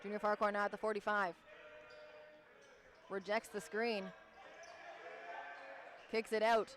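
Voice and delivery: brisk courtside call; live play-by-play. Acted. Play-by-play announcer calling the game for viewers. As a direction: call the action as it happens.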